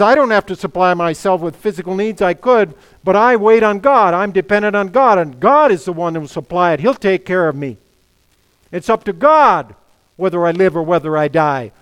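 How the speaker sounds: loud, forceful declaration of trust; impassioned preaching. Acted. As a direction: preach it out loud with full conviction.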